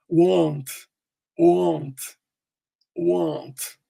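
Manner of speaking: "won't" with a rounded ou glide; ou sound. Shown as a demonstration. Teacher repeating for students, "won't" with an ou diphthong. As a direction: say "won't" slowly with the O as ou. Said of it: "Ele tem esse som de ou".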